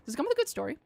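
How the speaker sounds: usual speaking voice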